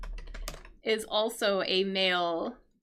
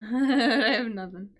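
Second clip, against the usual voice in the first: real laugh running into the words; laughing admission. Real, not acted. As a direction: give up with a laugh. Stuck for an answer, gives up.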